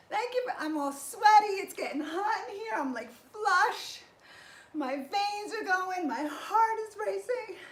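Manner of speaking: buzzing with post-workout energy; excited. Real, not acted. Flushed excitement after set.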